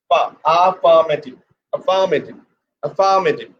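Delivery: AFFIRMATIVE with FA stressed and lengthened, a-FAA-ma-tiv; stress on second syllable. Shown as a demonstration. Teacher drilling pressure on the second syllable, stressed a-FA-mative. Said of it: "put pressure on the second syllable".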